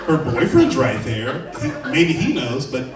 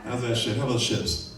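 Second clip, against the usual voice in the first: ordinary talk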